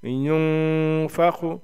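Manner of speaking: युनफखु with the न held long as a nasal hum; nasal ghunna. Shown as a demonstration. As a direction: hide the न of युनफखु in the nose and draw it out. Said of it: "नाक में आवाज़ को छुपा के".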